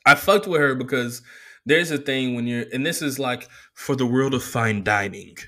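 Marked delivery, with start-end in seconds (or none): none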